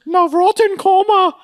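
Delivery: very high, exaggerated echo of the order; hammed-up waiter, pitch way up; waiter impression. Waiter repeating the diner's order, hammed-up high voice. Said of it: "And he goes".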